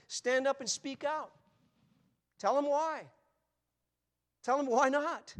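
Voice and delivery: loud, slow, ringing exhortation; fiery pulpit command; preaching at full force. Acted. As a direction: call the flock to speak out. Preacher exhorts congregation loudly.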